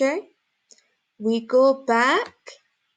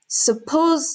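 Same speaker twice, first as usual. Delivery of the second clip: Suppose said higher and louder, drawn out; stress on Suppose; 5 semitones higher, 4 dB louder than usual. Shown as a demonstration. Teacher demonstrating emphasis for learners, stress on "Suppose". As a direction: lean firmly on "Suppose". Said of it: "my emphasis is on suppose".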